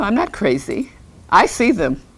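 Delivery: ordinary delivery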